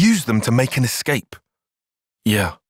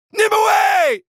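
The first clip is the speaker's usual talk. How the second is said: shouting a name; 4 dB louder than usual. Acted. Calling out to Nimue.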